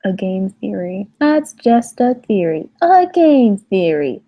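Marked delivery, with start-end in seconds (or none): 1.2-2.7 higher-pitched mock show sign-off; YouTuber catchphrase. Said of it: "Was that your impression?". 2.8-4.2 slow, higher, louder mock show tagline; YouTuber catchphrase. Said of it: "Was that your impression?".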